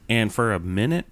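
usual speaking voice

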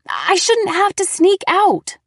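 high, loud angry protest; shaking with anger. A narrator's reading. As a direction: yell it, shaking with rage. Daughter shouting.

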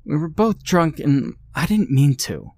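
pleading wife. Acted.

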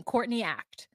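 usual speaking voice

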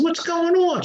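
high, startled call to the next room; alarmed call. Retold or imitated. Husband hearing his wife scream, high startled call.

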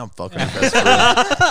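high, loud, slow cocky brag; brazen boast. Retold or imitated. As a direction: crow it like a proud cheater. Mimicking cheater's brazen boast.

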